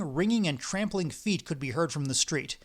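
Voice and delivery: ordinary delivery, nothing marked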